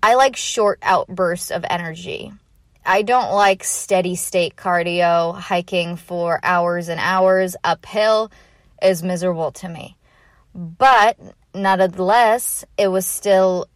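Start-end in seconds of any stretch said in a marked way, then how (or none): none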